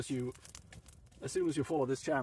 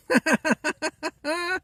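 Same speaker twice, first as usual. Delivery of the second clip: squeaky high teasing laugh; high giggle; 10 dB louder than usual. Real, not acted. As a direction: let out a shrill teasing giggle. Laughs after refusing to say.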